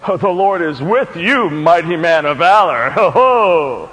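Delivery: mock-impressed, with a scoffing ho-ho; sarcastic. Preacher mishearing the angel's greeting, heavily sarcastic. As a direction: lay the sarcasm on thick. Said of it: "that could almost sound sarcastic".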